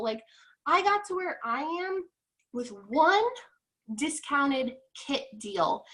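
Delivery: ordinary delivery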